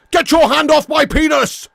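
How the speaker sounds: high, loud indignant yell; outraged arrested man. Retold or imitated. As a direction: yell at the officer grabbing you. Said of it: "Do the succulent Chinese meal guy".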